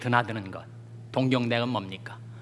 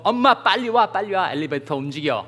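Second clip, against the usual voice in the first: put-on high, louder child calling mom to hurry; 다급한 아이; 6 semitones higher, 5 dB louder than usual. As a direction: call out urgently like a kid to mom. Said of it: "엘리베이터에 아이가". (엄마를 다급히 부르는 아이 목소리)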